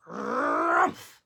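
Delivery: gruff bear grunt. Acted. Bear grunting at the blessing, gruff.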